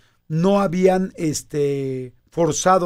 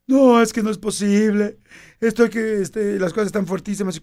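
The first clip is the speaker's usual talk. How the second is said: imitating crying friend; 4 semitones higher than usual. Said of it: "nos habla llorando".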